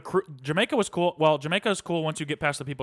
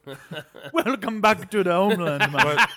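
hammed-up Jamaican accent, laughing through it; mock Jamaican accent; 5 semitones higher, 6 dB louder than usual. Guy imitating Jamaican locals greeting his friend, in a hammed-up Jamaican accent. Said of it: "Jamaican is the only accent".

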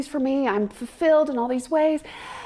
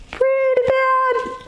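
wry self-mockery; 14 semitones higher, 3 dB louder, slower than usual. Real, not acted. Self-deprecating comment.

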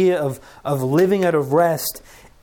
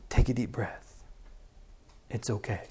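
softer, reassuring self-talk; calming himself; 4 semitones lower, 9 dB quieter than usual. Real, not acted. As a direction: settle your own nerves gently. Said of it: "nervous about today".

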